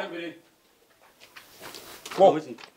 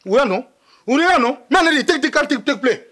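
normal talking voice

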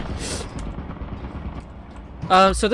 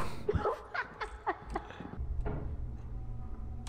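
breathless laugh; 17 dB quieter than usual. Real, not acted. Laugh after the scare.

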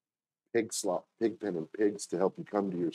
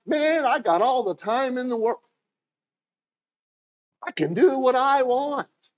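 carefree boaster; 13 semitones higher, 4 dB louder than usual. Retold or imitated. Someone waking up thinking life is endless, high carefree voice. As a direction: play it lazy and careless, higher.

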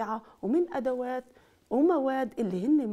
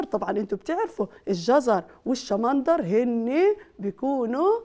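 put-on lively, louder teacher voice to kids; kindergarten teacher; 5 dB louder than usual. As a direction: coax the little ones, bright and loud. Teacher addressing young children.

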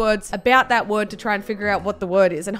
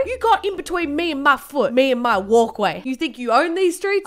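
mock street tough, pitched up; confrontational character; 2 semitones higher than usual. Swearer squaring up to the tripped old lady, mock confrontational. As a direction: pick a pretend fight. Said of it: "turned into a different person".

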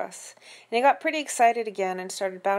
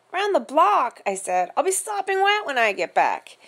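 whiny complaint; 8 semitones higher, 5 dB louder than usual. A narrator's reading. Boy complains.

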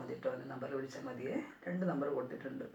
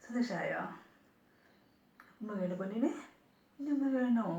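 put-on cooing baby talk; 4 semitones higher, 5 dB louder than usual. Baby talk offering kisses again.

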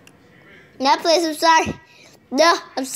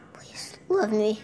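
whispered plea; 6 semitones lower, 11 dB quieter than usual. Pretend. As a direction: beg under your breath. Begging in whisper.

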